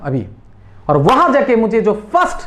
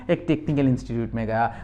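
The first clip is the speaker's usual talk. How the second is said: soft answer; 9 semitones lower, 7 dB quieter, faster than usual. Shown as a demonstration. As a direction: lower and softer model answer. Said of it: "जो जो colour red में है, उसमें ध्यान देना है".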